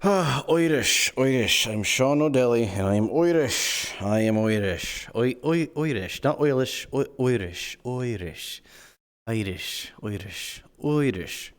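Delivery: put-on broad stage-Irish accent, Irish rolled into Oirish; hammed-up Irish accent. Streamer introducing his Irish character Sean O'Delly, hammy Irish accent. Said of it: "We're using our voice now".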